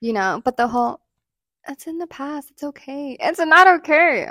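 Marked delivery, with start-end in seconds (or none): 1.7-3.2 soft, higher-pitched placating imitation; mock soothing. Mimicking boyfriend's soothing dismissal. 3.2-4.3 high, loud objection on "not okay"; emphatic rebuttal. Acted. Rebuttal to the imitated soothing.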